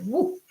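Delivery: sudden high woo; ninja cry. Retold or imitated. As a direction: yelp it like a ninja. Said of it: "like this, like a ninja".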